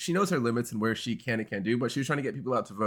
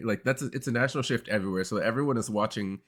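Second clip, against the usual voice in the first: ordinary delivery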